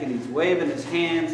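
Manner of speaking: ordinary talk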